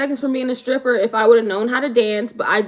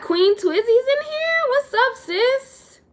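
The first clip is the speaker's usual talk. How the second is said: much higher, slow, sing-song hello to a friend; excited greeting; 14 semitones higher, slower than usual. Real, not acted.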